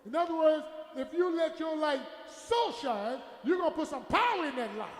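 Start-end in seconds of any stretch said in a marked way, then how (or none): 1.0-3.2 slow, with "so" lifted high and stretched; drawn-out emphatic "so"; stress on "so". Shown as a demonstration. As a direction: lean hard on "so" before "shine". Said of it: "Stress that word so".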